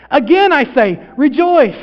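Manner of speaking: high, rousing declaration; exultant proclamation. Acted. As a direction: proclaim it joyfully to everyone. Reciting a joyful phrase.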